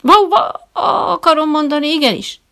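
loud, high flustered soldier's reply; snapping to attention. A narrator's reading. Startled visitor answering the trainer like a soldier, loud. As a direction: jump to attention and blurt it out. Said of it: "kapom magam haptákba".

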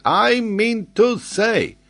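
put-on high, loud, slow French-accented retort; emphatic French geographer. Geographer answers doubting Glenarvan, emphatic. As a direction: throw the words back, each drawn out.

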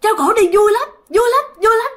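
high, strained imitation of a possessed grandfather; struggling possessed voice. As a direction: force the words out in a high voice. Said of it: "ông nội kể khó khăn lắm". (giọng nhập hồn cao, nói khó khăn)

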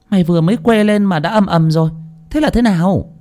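sharp mistress's rebuke; snapping. Acted. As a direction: snap at the noisy maid. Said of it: "Thị Liễu cũng gắt lên".

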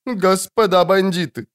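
high wailing plea to robbers; whining plea. A narrator's reading. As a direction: whine it like a frightened matron. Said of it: "заныла Матрона в роброне".

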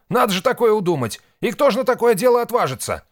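very high, loud astonishment; aghast exclamation. A narrator's reading. Husband dismissing who would dare it, aghast.

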